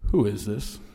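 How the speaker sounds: low, stern voice on the line; slow and stern. Retold or imitated. Man answering an unknown caller, slow and stern. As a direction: question it low, slowly and sternly. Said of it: "said slowly and sternly".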